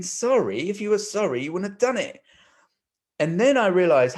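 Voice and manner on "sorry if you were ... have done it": high, scornful mock rebuttal; indignant inner retort. Silently scoffing back at the apologising stranger, indignant. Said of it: "And I'm thinking".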